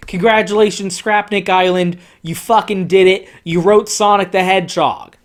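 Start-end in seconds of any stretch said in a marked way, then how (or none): none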